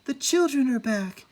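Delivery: high, eager calling voice. A narrator's reading. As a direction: call them in like a fond mother. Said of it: "she cried".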